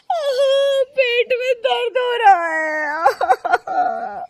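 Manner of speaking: loud, very high, drawn-out wail; hammed-up sobbing agony; crying out in pain. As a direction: howl slowly over your aching belly. Cries out from stomach pain.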